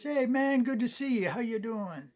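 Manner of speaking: high-pitched, excited country buddy; hammed-up gas station good ol' boy. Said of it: "his buddy stays and works at the gas".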